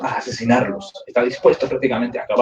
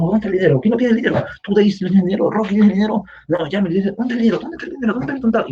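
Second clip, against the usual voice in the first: frantic search; 10 semitones higher, faster than usual. Acted.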